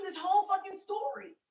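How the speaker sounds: high, loud disbelief; incredulous exclamation. Real, not acted. Telling friends about the made-up boyfriend story, loud incredulity. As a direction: burst out high and loud in amazement.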